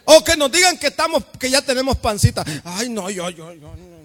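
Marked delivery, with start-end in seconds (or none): 2.6-3.9 low, fading whimper; mock hurt denial. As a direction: shrink away offended. Hurt man protesting. (negación ofendida, baja y apagada)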